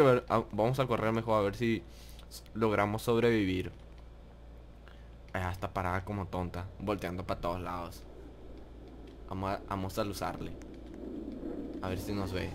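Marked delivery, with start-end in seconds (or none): 5.3-12.5 much softer, low hushed voice; whisper-quiet while sneaking. Real, not acted. Sneaking near the witch.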